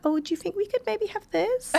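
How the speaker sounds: very high, soft, tentative request; meek asking voice. Retold or imitated. Woman mimicking herself asking for something, high, soft and meek. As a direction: shrink it into a tiny, high-pitched polite ask. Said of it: "my voice just went".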